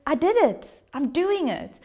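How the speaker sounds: a touch of triumphant glee; proud excitement. Retold or imitated. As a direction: announce your win with joy. Proud woman telling her success.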